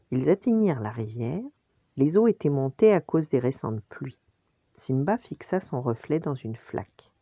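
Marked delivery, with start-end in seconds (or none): none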